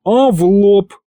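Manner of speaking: high-pitched, affronted retort; offended. A narrator's reading. Demon threatens the scientist back, offended. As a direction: snap back as if insulted. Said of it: "обиделся Аристофан".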